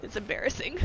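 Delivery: fighting back tears. Real, not acted. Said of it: "I don't wanna cry anymore".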